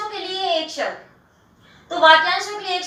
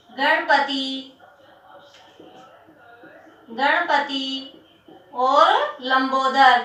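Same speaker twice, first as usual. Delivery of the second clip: each word drawn out slowly for writing down; slow dictation; 3 semitones lower, much slower than usual. Shown as a demonstration. Teacher dictating synonyms to students, slow and drawn out. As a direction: spell it out slowly so they can write.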